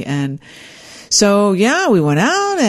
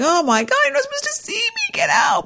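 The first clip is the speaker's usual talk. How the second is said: very high squeal dropping to a hushed Get out; mock shriek. Bride shooing the groom out of the bathroom, flustered. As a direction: shoo him out in a flustered panic. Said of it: "I'm like".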